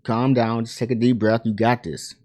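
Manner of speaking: soft, soothing mentor voice; calming reassurance. Retold or imitated. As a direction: quietly settle a panicking kid. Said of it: "who's telling him, like".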